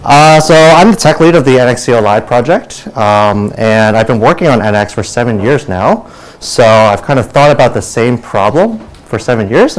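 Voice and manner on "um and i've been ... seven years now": ordinary talk, nothing marked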